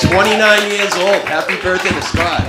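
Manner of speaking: shouting. Real, not acted. Comedian shouting happy birthday to Scott in the crowd, loud. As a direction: call it out across the room, big and loud.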